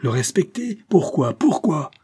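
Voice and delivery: high, incredulous angry questions; furious old man's retort; enraged. A narrator's reading. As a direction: fling the questions back in rage. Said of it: "repartit le vieillard avec rage".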